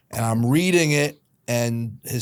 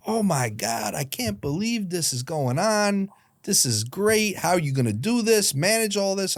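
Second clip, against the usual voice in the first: high-pitched, gushing amazement; excited teacher; 7 semitones higher than usual. Retold or imitated. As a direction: imitate a teacher gushing over big news. Teacher gushing over the news.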